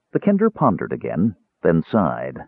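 ordinary delivery

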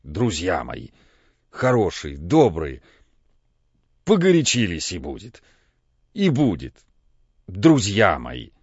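тихо и медленно, мягко; успокаивает друзей дрожащим голосом; ласковое бормотание. A narrator's reading. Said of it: "забормотал ласковым, дрожащим от волнения голосом". (ласково, дрожащим голосом)

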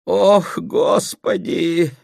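high, drawn-out groan; moaning. A narrator's reading. Mother distressed by the quarrel, moaning. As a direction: lament it with a long wail. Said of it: "застонала Любовь Сергеевна".

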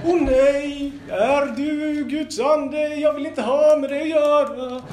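high, hammed-up panicky spirit; laughing as he plays the spirit; mock spirit voice. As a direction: squeal it like a frightened ghost. Possessed man voiced, playful.

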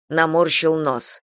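plain reading voice

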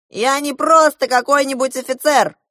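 loud, high bluster; officer yelling at a captive; shouting officer. A narrator's reading. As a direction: bellow it with self-importance. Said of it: "закричал он на меня".

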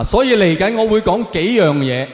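normal talking voice

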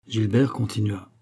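usual speaking voice

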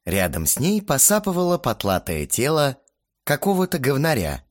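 ordinary delivery, nothing marked

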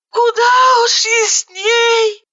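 high, slow crying cry; tearful sigh. A narrator's reading. Zhanna answering her friend with a slow tearful cry. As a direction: drag it out high through tears. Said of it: "вздохнула Жанна".

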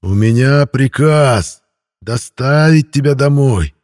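slow, loud, hissed threat; hissing feral vampire. A narrator's reading. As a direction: hiss it like a vampire. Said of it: "буквально прошипел вампир".

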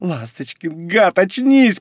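pleading cry to wake him; desperate shouting. A narrator's reading. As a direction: build from a hushed call to an urgent yell. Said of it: "кричала она".

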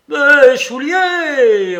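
long, high, very loud wail of outrage; old man's drawn-out bellow; roaring. A narrator's reading. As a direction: roar it out, stretching the words. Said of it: "rugit le père Soupe".

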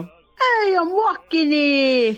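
New York accent. Shown as a demonstration. Guest showing the host her Brooklyn voice, mock New York accent. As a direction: ham up a slow New York street shout accent. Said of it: "I could do the, you know".

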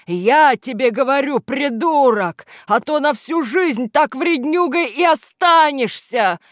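high, loud furious scolding; angry roaring. A narrator's reading. Boss threatening his doubting henchman, angry roaring. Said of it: "взревел Кляксич".

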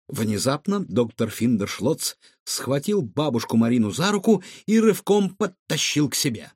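ordinary delivery, nothing marked

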